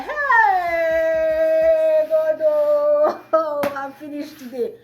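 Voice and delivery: mock despairing wail. Despairing outcry to tout.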